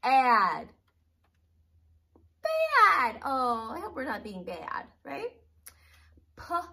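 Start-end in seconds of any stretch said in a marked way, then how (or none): 2.4-3.2 high playful voice. Pretend. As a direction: say it teasingly high.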